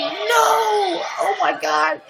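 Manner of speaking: high, loud, frantic wail; panicked shout. Retold or imitated. Owner shrieking over all the blood, high and frantic. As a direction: panic about the mess.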